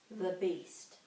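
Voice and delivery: usual speaking voice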